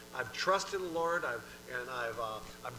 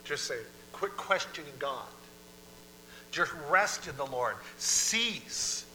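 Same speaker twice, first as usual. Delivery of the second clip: ordinary talk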